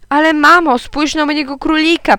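high, insistent begging; child's plea. A narrator's reading. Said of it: "poprosiłem". (błagalny, wysoki głos dziecka)

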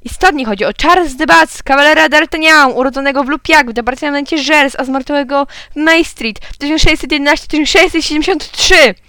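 excited radio host. A narrator's reading. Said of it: "krzyknął pan z radia". (podniesiony, wysoki głos prezentera radiowego)

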